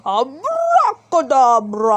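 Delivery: loud, slow, furious incantation; shouted magic word. A narrator's reading. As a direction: hurl the spell in rage. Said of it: "He shouted the magic word".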